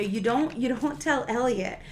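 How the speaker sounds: ordinary talk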